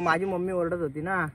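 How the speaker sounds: usual speaking voice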